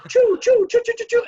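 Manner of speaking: high, loud choo-choo jeers; piercing high mocking choo-choos; mock train-whistle boo. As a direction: hoot him off the stage like a whistling train. Mimics audience booing him off.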